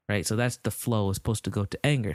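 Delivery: ordinary delivery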